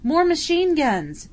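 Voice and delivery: loud, high, slow yell. Acted. Bill yelling to Tom over the battle. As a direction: yell it across the trench. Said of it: "Bill yelled to Tom".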